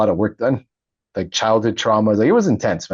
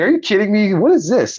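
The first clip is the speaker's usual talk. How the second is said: high-pitched retold disbelief; incredulous; 11 semitones higher, 3 dB louder than usual. Telling interviewer of his reaction to yoga schools, incredulous. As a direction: exclaim it in disbelief, pitch jumping up.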